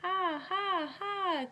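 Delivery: high squawking ha ha ha; gull laughter. A narrator's reading. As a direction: cackle like a laughing seagull, high. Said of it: "cried the laughing gulls".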